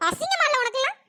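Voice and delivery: pitched high with contempt; scornful scolding. Acted. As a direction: shame her, voice climbing. Mother-in-law scolding.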